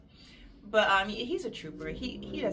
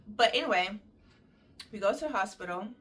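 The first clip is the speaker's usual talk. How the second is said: ordinary talk, nothing marked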